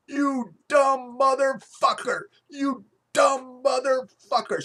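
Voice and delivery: high, loud, slow furious shout at the goalie; furious yelling. Retold or imitated. Suburban mom screaming at the goalie: high, loud furious yell. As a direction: scream the insult at him, dragged out. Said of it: "she's yelling".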